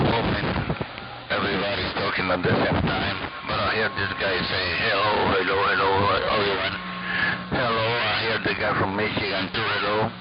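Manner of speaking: ordinary talk, nothing marked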